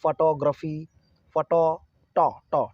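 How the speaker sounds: "Photography" as fuh-TAW-gruh-fi, then the TAW alone; stress on TAW. Shown as a demonstration. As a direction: break down "photography", isolating and hitting TAW. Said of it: "मतलब emphasise कहाँ करेंगे". ("Photography" में "टॉ" पर ज़ोर)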